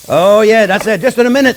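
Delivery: loud, happy Oh, yeah; excited shout. Real, not acted. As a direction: cheer it out. Exclaims at the searing steak.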